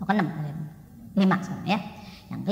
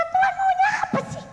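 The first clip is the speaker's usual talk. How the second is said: much higher, louder mock cry to God; exasperated shout; 7 dB louder than usual. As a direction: throw the question up at heaven. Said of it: "Dan kita berkata".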